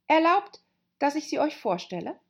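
put-on high, eager girlish voice; Alice politely introducing; young girl voice. A narrator's reading. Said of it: "sagte Alice".

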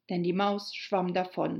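plain reading voice

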